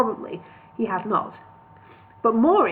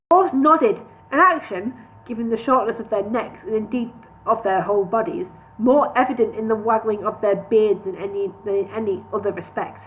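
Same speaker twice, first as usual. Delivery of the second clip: ordinary delivery, nothing marked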